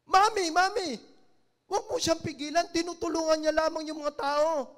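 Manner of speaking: put-on a child begging her mother to let him be; little girl pleading. Mute daughter speaking to her mother, high pleading child voice. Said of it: "Biglang yung anak ng babae na bata, nagsalita".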